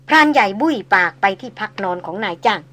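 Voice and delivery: ordinary delivery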